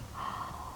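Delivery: soft astonished intake of breath; amazed gasp. Retold or imitated. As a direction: gasp in wonder. Old man sees his words.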